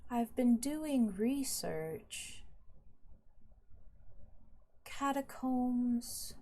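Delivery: lightly acted noble, drawn out very slowly; evasive noble. Noble hedging about his research on the catacombs, slow and evasive. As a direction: stretch each word as a lightly voiced evasive noble. Game master plays the Meistersinger.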